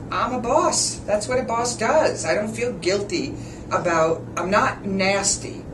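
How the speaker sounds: normal talking voice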